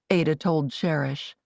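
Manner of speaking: ordinary delivery, nothing marked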